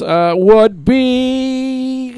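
villain voice. Acted. Continuing the villain voice bit.

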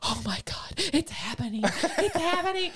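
high fangirl thrill, breaking into laughter; re-enacted giddy excitement. Acting out her thrilled reaction.

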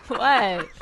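ordinary talk, nothing marked

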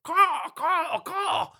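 high raven caw; rasping raven cry. Acted. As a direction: caw like a proud bird trying to sing. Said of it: "His rasping cry of".

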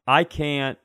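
normal talking voice

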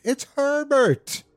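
very high, drawn-out scream. A narrator's reading. Wife screams that her son has returned, screaming. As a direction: scream the name out, stretching it. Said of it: "she screamed".

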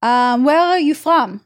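slow demo of an Israeli accent. Shown as a demonstration. Showing the co-host an Israeli accent, slowly. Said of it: "I can only do Russian or Israeli accent".

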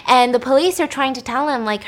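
ordinary talk, nothing marked

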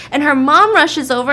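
ordinary talk, nothing marked

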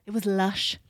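lilting attempt at a Welsh accent; put-on Welsh accent. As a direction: read it out as if Welsh.

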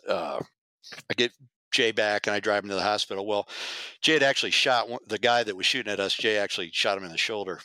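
usual speaking voice

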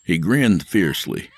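plain reading voice

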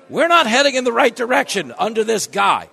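loud, high, heated rant; fired-up shout. Real, not acted. Host rallying his listeners, shouting in frustration. Ranting at the host's audience.

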